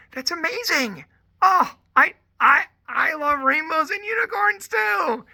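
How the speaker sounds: high-pitched squealing delight, climbing to a shout; gushing mock excitement. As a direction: gush along with a small child. Acts out gushing reply to child.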